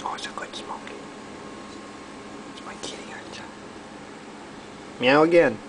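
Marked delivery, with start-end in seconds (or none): 0.0-3.4 hushed whisper to the cat. Real, not acted. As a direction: whisper it close, tender and secret. Cooing softly to the cat.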